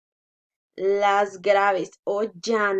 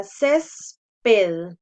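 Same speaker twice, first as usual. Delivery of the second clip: césped slow in two beats, "CÉS-ped"; slow teacher's demo of césped, strong first syllable; sounded-out syllables; 2 semitones lower, 4 dB louder than usual. Shown as a demonstration. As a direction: split césped, leaning on "cés".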